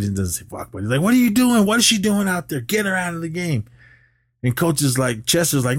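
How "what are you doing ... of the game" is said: angry yelling. Retold or imitated. Mullen runs onto the field at the coach, angry high yell.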